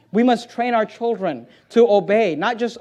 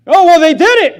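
high, loud mimicked excuse; hammed-up defensive protest; mock excusing parent; 9 semitones higher, 11 dB louder than usual. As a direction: brush it off in an exaggerated raised voice.

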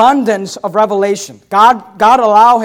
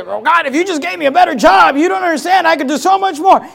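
high-pitched complaint, imitating a believer; mock whining plea; 6 semitones higher than usual. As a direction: whine to God for a favor, hammed-up. Mimics believer complaining to God.

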